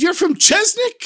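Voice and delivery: very high, rising incredulous question; excited disbelief. Acted. Master of the house hearing the visitor's town, excited. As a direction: squeak it out in thrilled astonishment. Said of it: "And he was so excited".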